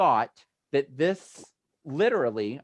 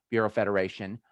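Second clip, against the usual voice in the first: ordinary delivery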